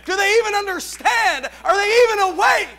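shouting preacher, voice near breaking. Acted. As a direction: cry it out at full force. Climax of preaching.

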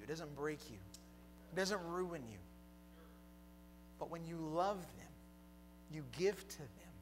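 normal talking voice

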